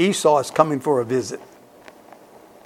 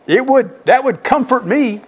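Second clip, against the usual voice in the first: excited pitch jump; 9 semitones higher than usual. Real, not acted. Preacher moved by God's angels.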